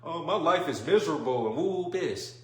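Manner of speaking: mock the gloomy friend's moaning; negative Nancy. Imitating a negative friend who complains about life. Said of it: "what you call a negative Nancy".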